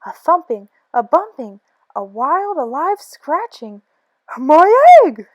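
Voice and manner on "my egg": much louder, higher cry; shout. Acted. Horton crying out as the egg squeaks, loud shout. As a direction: bellow it out in alarm. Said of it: "shouted Horton".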